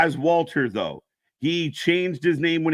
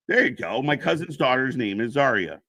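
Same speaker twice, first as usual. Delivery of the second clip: ordinary delivery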